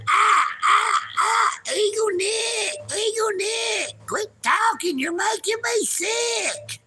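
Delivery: very high mock-crying squeal; whiny crybaby mockery. Mocking critics who want him to quit talking, high and crying. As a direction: whimper it like a crybaby.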